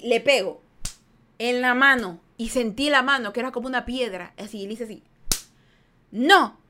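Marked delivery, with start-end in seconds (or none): 6.1-6.6 a short, loud angry "no"; retold scolding no, punched out; pissed-off no. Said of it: "Pero cabreada". (un no cabreado y seco)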